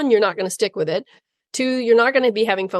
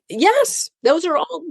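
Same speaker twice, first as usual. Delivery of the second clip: bright emphatic yes; 6 semitones higher, slower than usual. Acted. Granting owners permission.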